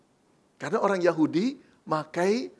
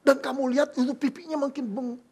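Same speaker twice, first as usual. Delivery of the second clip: higher, lively worried mother; Maria's mother worriedly telling the father; fussing mother; 6 semitones higher than usual. Acted. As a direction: fret like a gossiping mom.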